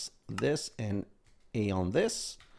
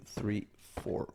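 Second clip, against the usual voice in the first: counting under breath; 9 dB quieter than usual. Real, not acted.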